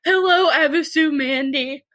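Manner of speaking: high, pitiful little voice; hammed-up sad reply to a brand; mock sad. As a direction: whimper it like a sulky child. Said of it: "and I was like".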